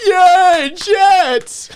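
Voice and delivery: high, loud sarcastic cheer; mock cheering doctors. Doctors mockingly cheering jets, high and loud. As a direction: fake cheer like thrilled doctors. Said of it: "the doctors are just like".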